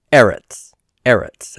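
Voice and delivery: eretz stressed on its opening "e"; stress on first syllable. Shown as a demonstration. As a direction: say "eretz" twice, front syllable stressed each time. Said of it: "on the first syllable".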